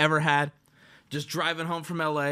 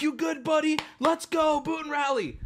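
high, rousing party encouragement; cheering buddy; 7 semitones higher than usual. Retold or imitated. Partygoers seeing Peanut vomit, high encouragement. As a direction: cheer him on, pitched up. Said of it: "everyone's like".

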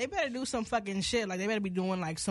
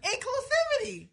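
shrill overlapping reality fight. Shown as a demonstration. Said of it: "Say, say it one more time".

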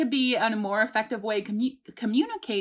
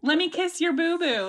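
put-on higher-pitched baby-talk soothing; parent babying a child; 6 semitones higher than usual. Parent to a child with a boo-boo, babying. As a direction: coo it like kissing a child's hurt better.

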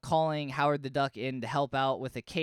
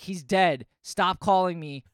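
louder, blunt and final; curt, annoyed; 7 dB louder than usual. Retold or imitated. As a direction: shut him down flat. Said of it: "And he's like".

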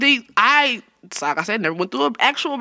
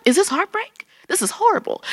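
mock despair; 10 semitones higher than usual. Retelling her breakup shock to friends, higher mock anguish. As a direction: whimper it like a dramatic discovery. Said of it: "I was like".

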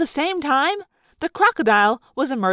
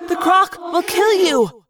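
higher-pitched alarmed cry; urgent warning; 6 semitones higher, slower than usual. Acted. Girl warning her friend of the croc, urgent warning. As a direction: punch out the warning, desperate.

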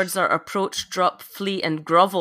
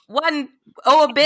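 higher-pitched, insistent order; exasperated command; 8 semitones higher, 3 dB louder than usual. Acted. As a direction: give in and repeat the command emphatically. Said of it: "All right, fine".

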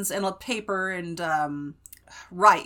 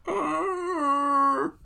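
high drawn-out groan of dismay; exasperated groan; 7 semitones higher than usual. Retold or imitated. Aunt hearing about Coco Melon, exasperated mock groan.

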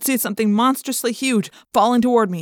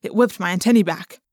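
plain reading voice

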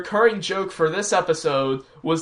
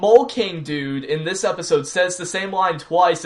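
usual speaking voice

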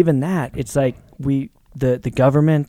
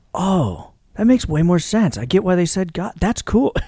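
delighted realization; 6 semitones higher than usual. Retold or imitated. John's delighted reaction, retold.

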